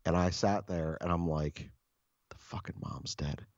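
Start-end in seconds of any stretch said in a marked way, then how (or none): 2.3-3.4 hushed, appalled aside; aghast whisper. Retold or imitated. Onlooker realising the mom died, aghast whisper. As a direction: whisper it under your breath, low and unvoiced-sounding. Silent thought during partner's call.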